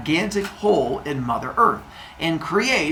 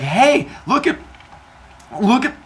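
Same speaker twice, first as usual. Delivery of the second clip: high-pitched, halting reaction; startled mock greeting; 8 semitones higher than usual. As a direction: act thrown by the sight. Father Sky sees the babies.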